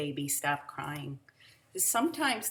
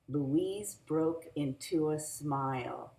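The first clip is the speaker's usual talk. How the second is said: ordinary delivery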